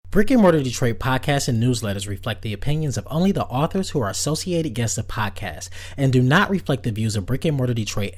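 loud, rushed disclaimer read; fast legal disclaimer. Acted. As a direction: rattle off the fine print.

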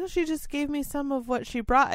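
high, airy innocent reply. Retold or imitated. Narrator to her boss, high, innocent, playing dumb. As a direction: play dumb, sweet and light. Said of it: "I was like".

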